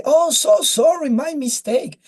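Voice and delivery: high, effusive mock apology; over-friendly chatbot. Chatbot apologising to a client, imitated in a high, over-friendly voice. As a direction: gush an apology like an eager-to-please assistant. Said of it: "very friendly".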